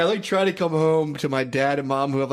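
normal talking voice

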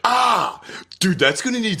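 high startled ah, then urgent; mock alarm at a bloody cut. Roommate retelling the knife cut, alarmed cry.

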